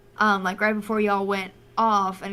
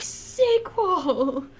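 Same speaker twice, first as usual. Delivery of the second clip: announcer reveal; 8 semitones higher than usual. Retold or imitated. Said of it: "they're just like".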